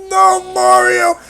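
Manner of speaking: high, slow, hammed-up scream; mock despairing cry. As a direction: wail after him in fake horror. Mock despair as Mario jumps.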